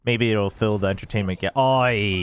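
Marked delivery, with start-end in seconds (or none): 1.6-2.2 broad Aussie "Oi"; Australian accent. Pretend. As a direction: bark it like an Aussie bloke. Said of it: "my Australian accent".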